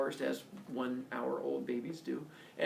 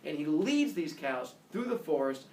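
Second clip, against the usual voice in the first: plain reading voice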